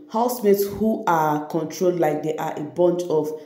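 ordinary talk, nothing marked

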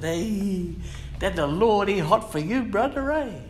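hammed-up friend; imitating the blackfella. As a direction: voice the mate who greeted him. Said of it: "And now blackfella said".

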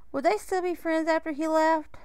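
plain reading voice

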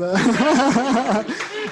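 high bright burst of laughter; real laugh at the compost answer. Real, not acted. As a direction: laugh out loud, amused.

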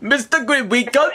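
high-pitched, put-on foreign caller; Alibaba. Collector trying to get through, high foreign character voice. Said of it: "this is Alibaba".

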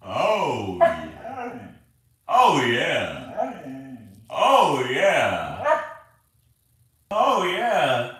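howl-like oh yeah to a husky; husky-style coaxing. Pretend. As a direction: croon it to get the dog howling.

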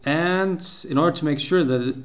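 normal talking voice

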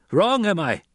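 high, loud challenge; indignant demand. A narrator's reading. Captor challenged by the son, demanding indignantly. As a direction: throw it back as a demand. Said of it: "Gurney demanded".

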